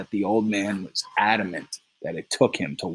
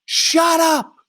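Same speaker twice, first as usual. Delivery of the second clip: furious shout; 7 dB louder than usual. Acted. Three explodes at Eight.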